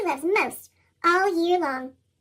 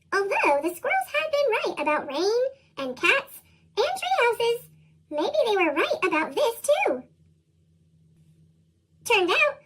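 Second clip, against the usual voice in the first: ordinary delivery, nothing marked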